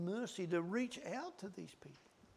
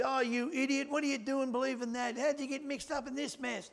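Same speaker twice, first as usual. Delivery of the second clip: higher, harsh mocking scold; 6 semitones higher, 4 dB louder than usual. Preacher mocking a harsh church member, scornful. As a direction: sneer at the fallen brother. Scolding someone who strayed.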